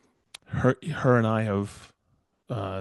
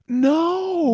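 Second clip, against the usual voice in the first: high, drawn-out mock-horrified no; retold refusal, pitched way up; emphatic refusal; 4 dB louder than usual. Retelling the answer to being asked to install it, emphatic refusal.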